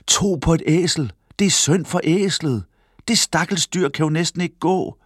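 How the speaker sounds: high-pitched grumpy calling out; sour shouting. A narrator's reading. Men pitying the overloaded donkey, calling out sourly. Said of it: "Mændene råbte med sure stemmer".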